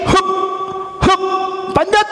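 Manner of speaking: high, effortful climbing grunts; straining effort. Acted. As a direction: push up with each word. Climbing again. (mendaki dengan susah payah)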